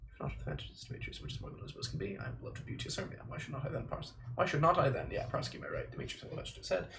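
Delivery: mumbled rehearsal under the breath; whispered run-through. Acted. Actor drilling memorized lines, whispered.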